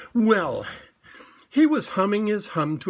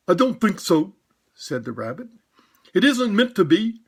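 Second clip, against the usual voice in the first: higher, louder put-on rabbit voice; disguised voice; 4 semitones higher, 5 dB louder than usual. Rabbit disguising his voice to fool Pooh. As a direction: Rabbit hiding who he is. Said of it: "a very different sort of voice".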